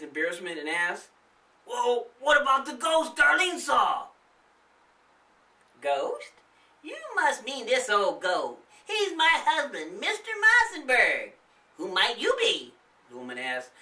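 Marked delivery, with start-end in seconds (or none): 1.7-4.1 put-on older boy voice. Boy asking, embarrassed. 5.8-12.7 high, chirpy granny voice; a friendly old lady greeting guests; old woman voice. Acted. The old woman greeting the kids, high and chirpy.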